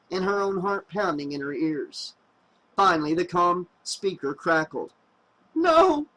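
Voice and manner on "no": high sharp teary refusal; teary but firm. A narrator's reading. Girl in the shuttle refusing to open, teary firm no. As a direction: refuse firmly through tears. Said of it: "in a teary but firm voice".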